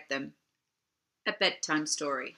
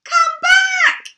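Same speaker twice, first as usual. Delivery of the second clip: panting dragon calling, much higher and louder; puffed cry; 14 dB louder than usual. Acted. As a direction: call out breathlessly while lumbering after them. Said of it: "he puffed".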